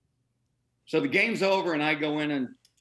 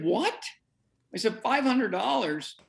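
high-pitched retold disbelief; incredulous; 9 semitones higher than usual. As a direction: echo it back in shock. Retells reaction to surprise fine.

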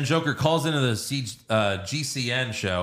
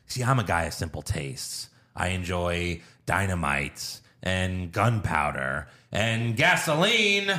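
Joker; 4 semitones lower than usual. Retold or imitated. Villain telling gangsters his simple tastes, slow and theatrical. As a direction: savor the list slowly, then lift sharply at the end. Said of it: "so then we have Joker".

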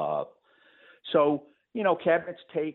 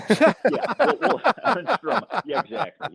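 high-pitched laughing agreement; words breaking into real laughter; 7 dB louder than usual. Real, not acted. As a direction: answer while cracking up. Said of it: "Chuck knows I'm laughing".

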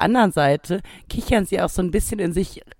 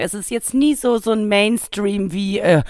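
ordinary delivery

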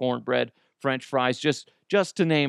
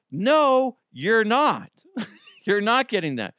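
higher, louder firm no; wife refusing; 10 semitones higher, 5 dB louder than usual. Retold or imitated. Wife refusing son's rib order, high and loud. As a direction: shut it down flat out. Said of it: "my wife's like".

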